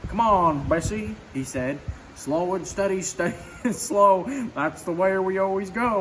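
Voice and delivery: Goofy voice. A narrator's reading. Goofy urging Bessie up the hill, lightly voiced. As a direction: coax the old car up the hill.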